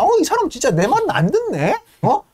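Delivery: very high, haughty threat; threatening heiress. Retold or imitated. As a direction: threaten to fire him, shrill with entitlement. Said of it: "막 이러는 거죠".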